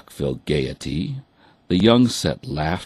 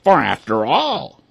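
high, louder duck voice; declaring ducks; 9 semitones higher, 5 dB louder than usual. A narrator's reading. Ducks declaring sisterhood to the hens, high. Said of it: "the ducks declared".

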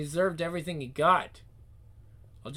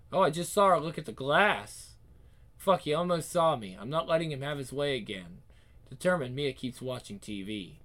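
ordinary delivery, nothing marked

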